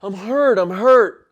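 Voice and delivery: hurt and pleading at full volume; pained yell. Retold or imitated. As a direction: yell back to make her stop. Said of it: "I'm yelling back".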